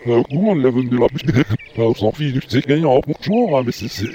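reversed speech; played backwards. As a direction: talk as if the tape runs backwards. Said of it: "comme si tu parlais à l'envers".

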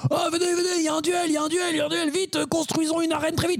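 squeaky bystander rallying everyone to the duel; excited high crowd voice. Acted. High squeaky crowd calling everyone to watch the duel.

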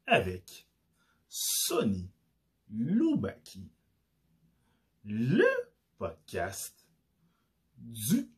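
slow, drawn-out announcer with swooping pitch; big ring-intro delivery, long pauses between bursts; show announcer. Acted. Host announcing the podcast to listeners, grand announcer voice.

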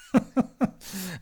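a quick amused laugh; brief chuckle after the joke; short laugh. Real, not acted. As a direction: laugh at your own pun. Laughs at his own name pun.

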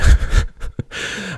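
quick high breathy laugh; a delighted airy chuckle. Real, not acted. Laugh while praising game.

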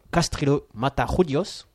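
careful Spanish accent on "Castrillo Matajudios", a bit slow. Shown as a demonstration. Host proudly gives co-hosts the name, Spanish accent. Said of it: "alors je vais vous le faire en espagnol".

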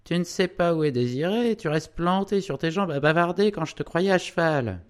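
high, loud nagging wife; scolding cry. A narrator's reading. Wife scolding her husband for standing around chatting, high and loud. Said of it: "cria tout à coup une petite femme".

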